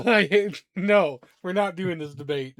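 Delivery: loud, high protest through amusement; mock exasperation. Real, not acted. Game master refuses the gyro debate, loud playful exasperation. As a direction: shut the debate down loudly.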